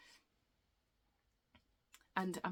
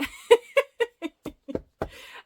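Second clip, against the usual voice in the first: breathy high laugh; 9 dB louder than usual. Real, not acted. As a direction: burst of airy, high laughter. Laughing at her own slip.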